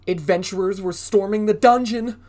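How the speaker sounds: loud, high, urgent narration; dramatic. A narrator's reading. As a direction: build the danger.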